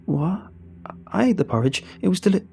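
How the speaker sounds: high-pitched, flustered denial; alarmed protest. Acted. Accused narrator protests, cut off.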